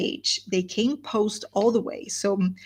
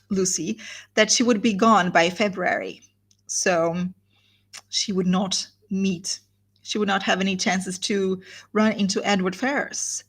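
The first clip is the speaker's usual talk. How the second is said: ordinary delivery, nothing marked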